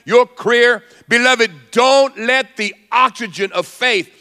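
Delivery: high-pitched, driving sermon delivery; impassioned preaching. Acted. Preacher urging the congregation to guard their words, impassioned. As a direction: preach it urgently, pitch lifted throughout.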